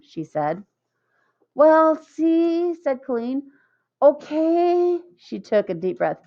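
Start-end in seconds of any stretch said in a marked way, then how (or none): 1.6-5.0 higher, hesitant and breathy nerves; nervous hesitation. A narrator's reading. Colleen nervously working up courage to ask Jeff, higher hesitant. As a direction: work up the courage.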